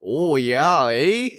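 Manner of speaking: hammed-up Canadian, lifting to a high "eh?"; playful stock Canadian lilt; Canadian accent. Co-host trying out a Canadian accent for the sign-off, hammed-up Canadian. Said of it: "I was gonna say Canadian".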